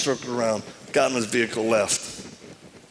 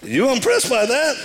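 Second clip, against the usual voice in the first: high proud question; 10 semitones higher than usual. Retold or imitated. Proud boast to girlfriend.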